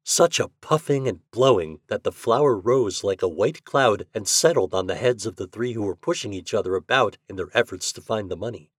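ordinary delivery

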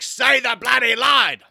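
high, slow shouted demand. Retold or imitated. Director Mario yelling at the actor to say his line. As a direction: bark the order at the actor. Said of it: "And he's like".

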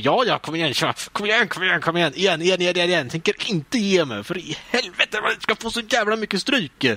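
stubborn comeback chant; determined gamer hype. Retold or imitated. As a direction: refuse to give up, pushing on. Spamming X after losing.

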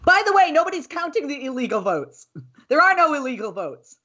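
high incredulous mom voice; sarcastic high voice. Real, not acted. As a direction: pitch it way up to mock the claim. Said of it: "My kids hate when I do that".

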